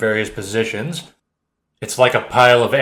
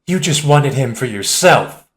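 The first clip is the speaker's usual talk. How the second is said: accusing; 4 semitones higher, 4 dB louder than usual. Acted. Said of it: "another accuses".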